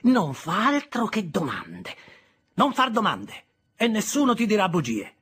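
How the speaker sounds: loud, high, slowed scolding. Acted. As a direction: scold the boy sharply. Sister scolds the boy.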